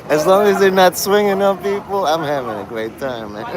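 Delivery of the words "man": ordinary talk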